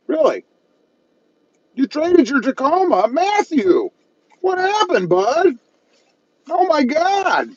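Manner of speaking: high-pitched, hammed-up disbelief; mock dismay. Streamer reacting to viewer trading his Tacoma, mock dismay. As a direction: wail in pretend shock, voice way up high.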